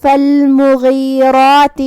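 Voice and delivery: فالمغیرات said slowly, with the ra and the alif after it heavy and full-mouthed; heavy ra demo. Shown as a demonstration. Teacher shows students the heavy ra, slowly. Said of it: "ہم را کو اور مد الف کو دونوں کو تفخیم سے ادا کریں گے".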